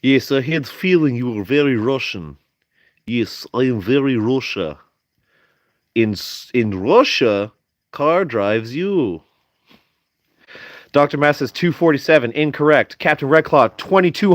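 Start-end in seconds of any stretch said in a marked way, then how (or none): 0.0-9.2 mock Russian accent. Joking with Dimitri about being very Russian, mock Russian accent. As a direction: play it up as a very Russian speaker. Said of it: "I know how speak Russian accent".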